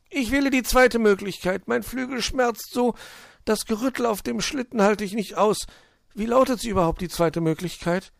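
hohe, klagende Vogelstimme; wehleidiger kleiner Vogel. Acted. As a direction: klag als verletztes Vögelchen, hoch und bestimmt. Zwitschi complains and chooses. (wehleidig quengelnder kleiner Vogel)

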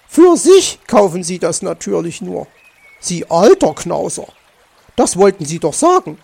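loud, mocking retort; accusing interruption. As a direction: finish his sentence for him, accusingly. Magpie interrupts and accuses fox. (laut und vorwurfsvoll dazwischengefahren)

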